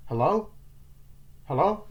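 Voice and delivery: high questioning hello, voice lifted; calling out. Acted. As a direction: call out to see who's there. Acting character calling into empty place.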